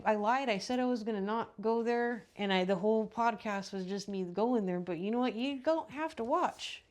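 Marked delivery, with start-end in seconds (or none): 5.3-6.8 slowed "You don't have to watch." with rising don't; drawn out, daring them to leave; slow pointed aside. Real, not acted. Dismissive aside to viewers.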